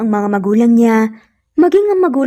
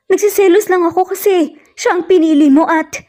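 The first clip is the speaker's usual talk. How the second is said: tearful plea; 7 semitones higher than usual. Acted. Natasha begs, crying.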